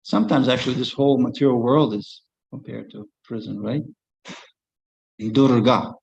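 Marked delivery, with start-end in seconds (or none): none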